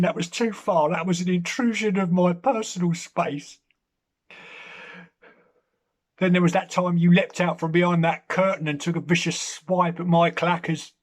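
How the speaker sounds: mock-serious breakup voice to the cat; mock breakup speech. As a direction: dump the cat like a jilted lover.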